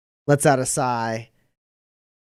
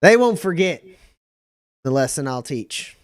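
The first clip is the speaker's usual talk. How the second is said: hearty captain; 5 semitones higher, faster than usual. Acted.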